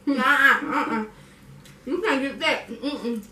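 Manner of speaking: ordinary delivery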